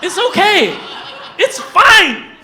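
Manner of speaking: high, loud yelled reassurance; yelling. Real, not acted. Reassuring the audience about cousins, loud yell. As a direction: shout it to calm the crowd. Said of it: "Sorry for yelling".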